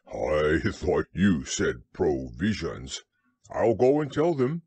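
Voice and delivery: contented, lightly voiced bear; mildly happy, slow start then lifting; happy Pooh. A narrator's reading. Pooh learns it means food, mildly happy. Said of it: "said Pooh happily".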